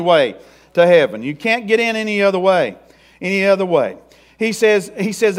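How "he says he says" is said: ordinary talk, nothing marked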